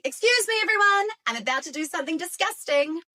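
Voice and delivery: high, fast, fussy announcement; a cat primly warning everyone; cat announcing its hairball in a high, fast voice; dramatic cat. Retold or imitated. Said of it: "It's like they're saying".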